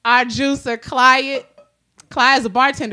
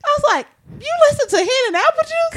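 excited; 3 dB louder than usual. Retold or imitated. Excited retold reply to fan.

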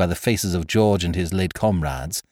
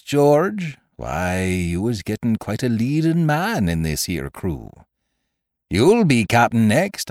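Silver. Acted. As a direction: needle him with sly, unhurried politeness.

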